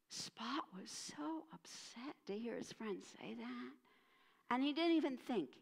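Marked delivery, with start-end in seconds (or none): 0.1-3.7 whispered "Spot was so upset to hear his friend say that."; whispered sadness. A narrator's reading. Narrator telling of Spot's hurt, soft whisper. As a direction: hush it, heartbroken.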